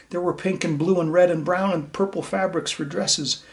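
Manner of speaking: put-on lively read-aloud for young listeners; bright, gentle storyteller voice; story reading. A narrator's reading. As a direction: read it to the class like a picture book. Reads storybook to children.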